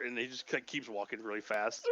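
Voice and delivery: ordinary talk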